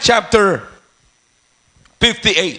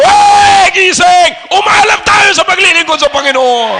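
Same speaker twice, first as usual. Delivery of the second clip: very loud, high wake-up yell; rousing preacher's shout; 6 semitones higher, 7 dB louder than usual. Shown as a demonstration. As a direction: bellow at sleepers to stir them. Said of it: "pero pag nandito ako".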